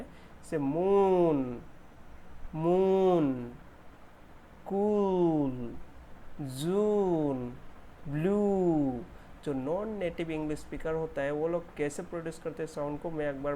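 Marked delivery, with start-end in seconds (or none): 0.0-9.0 "मून", "कूल", "जून", "ब्लू" with a long stretched oo; slow, drawn-out vowels. Shown as a demonstration. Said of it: "कुछ word मैंने लिखा है". (लंबे ऊ के साथ धीरे-धीरे)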